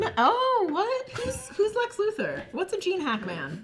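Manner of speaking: high, soft, puzzled voice; mock confused.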